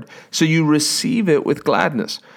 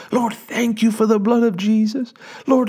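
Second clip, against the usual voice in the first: rejoicing prayer; 9 semitones higher than usual. Retold or imitated. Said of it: "and you're just quiet".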